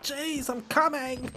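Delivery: put-on slow, high, whiny kid voice; a hint of a put-upon child; annoyed kid. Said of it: "sounded like a parent and child".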